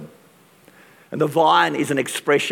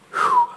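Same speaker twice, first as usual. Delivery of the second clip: breathy high whew. Real, not acted. Preacher catches breath.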